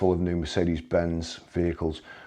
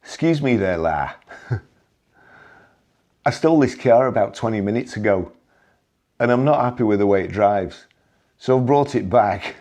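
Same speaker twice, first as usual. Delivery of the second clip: put-on higher, louder cheeky Liverpudlian accent; 3 semitones higher, 4 dB louder than usual. Joking to colleague Stuart as the car thief, in a Liverpudlian accent. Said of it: "in my best Liverpudlian accent".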